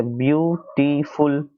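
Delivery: Beautiful slowly as "view - टी - फुल"; three-syllable demonstration. Shown as a demonstration. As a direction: break beautiful into three separate beats. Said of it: "तीन syllables में use करते हो".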